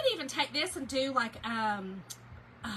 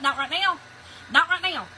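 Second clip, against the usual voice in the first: mad refusal; 3 semitones higher, 6 dB louder than usual. Retold or imitated. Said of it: "y'all, I was mad".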